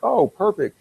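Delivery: imitated high-pitched delighted reaction; delighted approval. Interviewer hearing he worked on Running Scared, delighted. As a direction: pipe up, mock-impressed, pitch jumping high. Retold interviewer impressed by movie credit.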